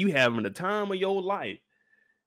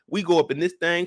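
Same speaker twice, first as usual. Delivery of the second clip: normal talking voice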